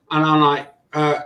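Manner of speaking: usual speaking voice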